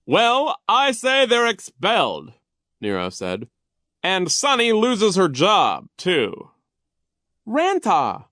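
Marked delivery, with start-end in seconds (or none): 0.1-2.3 loud, high bossy pronouncement; pompous Vice Principal Nero. A narrator's reading. Narrator plays Vice Principal Nero. 4.0-6.5 loud, high bossy pronouncement; pompous Vice Principal Nero. A narrator's reading. Narrator plays Vice Principal Nero. 7.5-8.3 put-on high, loud baby outburst; small child's shrieked protest. A narrator's reading. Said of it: "Sunny shrieked".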